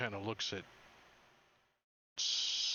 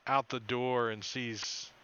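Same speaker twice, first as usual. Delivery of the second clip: usual speaking voice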